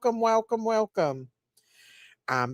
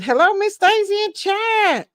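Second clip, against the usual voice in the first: much higher, louder put-on voice for the chat message; reading a viewer's greeting; 10 semitones higher, 5 dB louder than usual. Streamer reading Yvonne's chat greeting aloud, high put-on voice. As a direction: voice the greeting high and bright like a chatty fan. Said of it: "Yvonne said".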